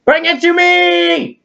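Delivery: high, slow shouted demand. Pretend. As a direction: call out a royal command. Ordering friend for ice cream.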